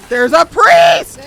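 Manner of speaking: loud, slow alarmed shout; startled shout at a priest. Real, not acted. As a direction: yell out the warning. Spotting enemy in game.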